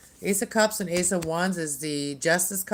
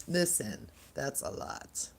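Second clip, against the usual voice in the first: whispered aside; 8 dB quieter, slower than usual. Real, not acted. Laughs at many cards.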